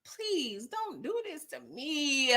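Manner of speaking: soft, slow mock-tearful plea; hushed, playful begging; near-tears pleading. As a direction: beg quietly, as if about to cry. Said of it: "I'm about to start crying".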